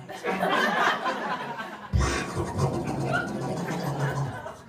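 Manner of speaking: unvoiced laughing wheeze; a silent wheezy laugh at his own joke; breathy wheezing laugh. Real, not acted. Comic laughing at himself, wheezing.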